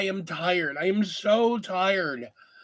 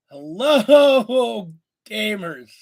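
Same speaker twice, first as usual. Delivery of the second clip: loud greeting; 2 semitones higher, 7 dB louder, much slower than usual. Real, not acted. Greeting the gamers.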